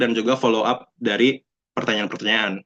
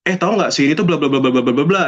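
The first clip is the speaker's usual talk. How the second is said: higher-pitched gossip running into babble; mock gossiping; 4 semitones higher than usual. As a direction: blurt it out like sudden gossip. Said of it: "Tiba-tiba ngegosipin sesuatu". (pura-pura ngegosip, nyerocos tiba-tiba)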